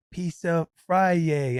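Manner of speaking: put-on slow, stretched-out kid words; imitating a tot's proud announcement; toddler imitation. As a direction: sound it out like a little one. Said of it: "she's like".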